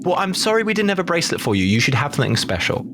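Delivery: ordinary delivery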